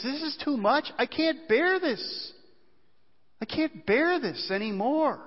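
high, pleading voice of Jesus in the garden; anguished Jesus. Acted. Preacher voicing Jesus in the garden, pleading and high. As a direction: let the words strain upward as if they can't be borne. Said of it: "what Jesus is saying is".

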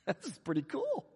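soft chuckling marvel, rising at the end; quietly tickled wonder, acted; delighted little aside as Peter; giddy amazement. Acting Peter stepping onto the water.

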